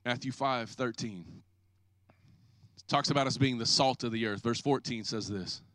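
low, soft, unhurried reading of verses; quiet scripture reading. Acted.

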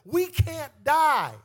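loud, sung-out preaching with the organ; full-force preaching. Acted. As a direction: whoop it over the music like a revival preacher. Preaching climax.

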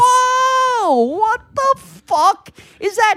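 high, loud, slow startled shout; shocked yell. Retold or imitated. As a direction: yell it like something just lunged at you. Startled by the caged cat.

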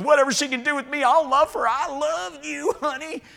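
high, put-on sweet-talk voice; hammed-up declaration of love, drawing out the "I love you"; mock lovestruck man. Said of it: "Man love goes".

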